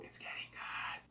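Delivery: soft whispered aside; a viewer whispering while hooked on the plot; excited whisper. Real, not acted. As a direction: lean in and whisper it. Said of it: "I was like".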